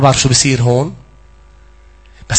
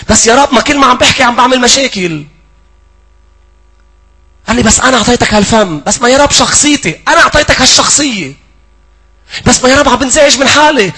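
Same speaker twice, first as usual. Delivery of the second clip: full-force preaching; 9 semitones higher, 4 dB louder than usual. Acted.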